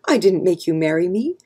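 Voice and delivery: ordinary delivery, nothing marked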